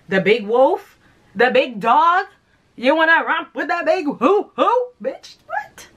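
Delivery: cartoonish mock tough-dog snarl; big dog persona. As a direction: bark it out as the big bad dog. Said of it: "I'm getting out of character".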